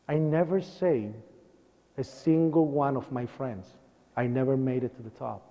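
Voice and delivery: low, subdued and halting; real grief held quietly; sad. Real, not acted. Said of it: "And you know, it's sad".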